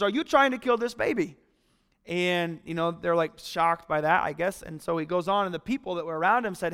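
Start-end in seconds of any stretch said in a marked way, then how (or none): 0.0-1.4 retold exasperated outburst, higher pitched; raised-pitch mock exasperation; frustrated. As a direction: demand it in frustration, as if quoting someone fed up. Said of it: "Doctor Mangalwadi is getting frustrated".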